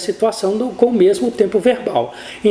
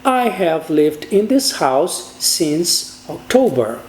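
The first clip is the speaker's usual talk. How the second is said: slow model English; 3 semitones lower, much slower than usual. Shown as a demonstration. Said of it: "poderia ser dita".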